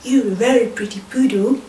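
low, cheerful little dog voice; happy sausage dog. Acted. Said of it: "said Geoffrey happily".

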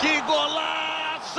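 high, slow shouted goal call; explosive goal commentary. Acted. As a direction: belt out the goal.

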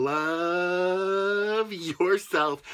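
very slow and tender; sincere. Real, not acted. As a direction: stretch it out lovingly. Heartfelt closing message.

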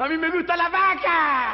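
high, hammed-up cry; impassioned declaration. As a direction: proclaim love for the cow. Réplica exaltada. (declaración exaltada y aguda)

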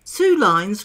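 loud, eager call; excited cry. A narrator's reading. Mock Turtle breaking in on the dance instructions, excited cry. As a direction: cry it out loudly. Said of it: "cried the Mock Turtle".

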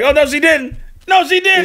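high, loud delighted squeal; gleeful Rufus. Retold or imitated. Imitating Rufus loving the article, high and gleeful. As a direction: squeal it with gossip glee. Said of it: "Rufus over there sitting like".

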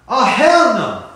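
loud punchy refusal, hell hit hard; emphatic hell no. Shown as a demonstration. Teacher demoing the slang refusal, loud and punchy. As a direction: slam it down loud. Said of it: "I really love it".

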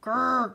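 imitated growl; short rolled grrr; dog growl. A narrator's reading. Doctor Livingston at the ghost, growling. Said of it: "growled Doctor Livingston".

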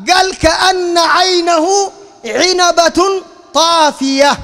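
preacher slowing right down to stress the quote; slow emphatic quote. Shown as a demonstration. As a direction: drum the hadith in word by word. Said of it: "بالياء".

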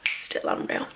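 breathy whispered repeat; repeating to herself in a whisper. Real, not acted. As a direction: whisper it to yourself. Repeats the line, then whispers.

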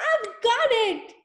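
the eureka moment; excited cry. Acted. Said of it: "she cried".

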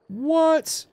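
high, outraged what; indignant what. Real, not acted. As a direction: shoot up in disbelief. Exasperated at the game.

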